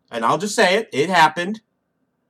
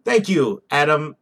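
usual speaking voice

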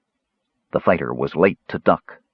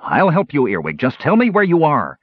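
raised, higher urgent call; 6 semitones higher, 3 dB louder than usual. A narrator's reading. Fighter offering help to the hidden kender, calling out. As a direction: call across to a lost friend. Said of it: "the fighter called".

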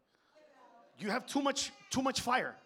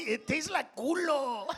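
slow, higher, gleeful put-on voice; happy imitation; 6 semitones higher, slower than usual. Mocking a happy friend, slow and gleeful. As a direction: savour it like a delighted kid. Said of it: "Did you hear him all happy?".

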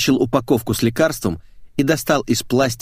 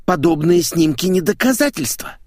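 higher, flustered protest; a mildly lost, higher-pitched objection; 8 semitones higher, 3 dB louder than usual. Acted. Editor objecting to the photos, flustered. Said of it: "растерянно заявил Митяев".

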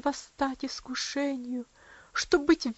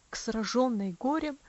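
plain reading voice

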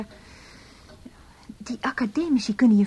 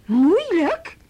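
disbelieving echo; 5 semitones higher, 8 dB louder than usual. Acted. Echoes husband's word in disbelief.

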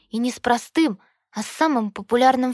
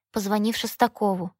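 plain reading voice

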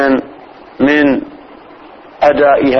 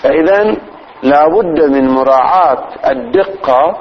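ordinary talk

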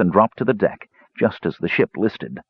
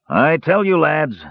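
higher-pitched, mock-solemn dwarf; solemn dwarf pitched up for a declaration; 8 semitones higher, slower than usual. A narrator's reading. Dwarf addressing the captive lads, solemn. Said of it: "he said solemnly".

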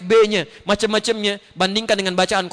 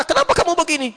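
higher, mock-angry parent; scolding parent; 11 semitones higher than usual. Parent angrily scolding their misbehaving child, hammed up.

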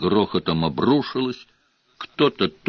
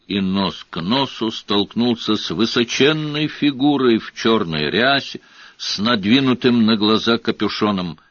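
usual speaking voice

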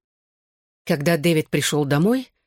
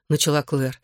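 usual speaking voice

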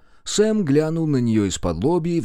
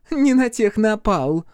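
high, louder, haughty boast; scornful huff; 11 semitones higher, 5 dB louder, slower than usual. A narrator's reading. Chloe boasts the blackmailer got nothing, scornful huff. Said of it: "Хлоя фыркнула, задрав нос".

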